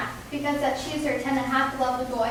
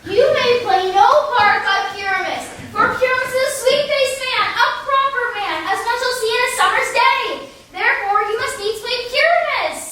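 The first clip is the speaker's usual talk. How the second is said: loud flattering; 8 semitones higher, 5 dB louder than usual. Acted. Quince flatters Bottom loudly.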